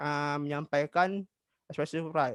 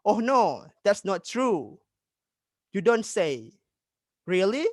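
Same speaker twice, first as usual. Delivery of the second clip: mildly hammed-up surprise, pitched up; surprised reaction; 3 semitones higher, 4 dB louder, slower than usual. Shown as a demonstration. Teacher giving students example responses to news, demonstrated surprise. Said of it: "memberikan ekspresi terkejut seperti contoh".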